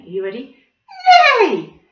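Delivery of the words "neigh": loud playful horse neigh; stripy neigh. Shown as a demonstration. Acting a zebra for the children, loud neigh. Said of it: "we've got to do a stripy neigh".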